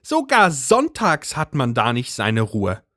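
high agitated housewife voice; Brigitte's voice. A narrator's reading. Brigitte complains.

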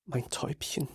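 choked whisper. Acted. Father at his comatose daughter's bed, barely audible whisper. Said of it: "Mehr bringe ich nicht über meine schmerzverzerrten Lippen".

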